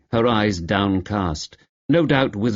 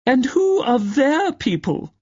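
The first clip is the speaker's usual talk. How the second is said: very high, slow haughty grande dame; mock the aunt's snooty drawl; snobbish aunt imitation; slower than usual. Niece mocking her snobbish aunt at dinner, haughty and high. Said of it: "in clear imitation of her aunt".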